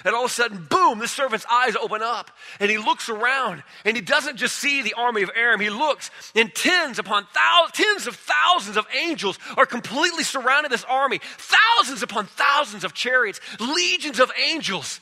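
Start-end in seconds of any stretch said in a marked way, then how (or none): 6.3-15.0 preaching shout. Real, not acted. Preacher's climax, angels surrounding army.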